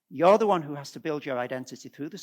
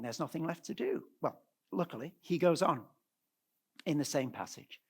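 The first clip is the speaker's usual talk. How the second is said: usual speaking voice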